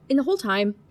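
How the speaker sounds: ordinary talk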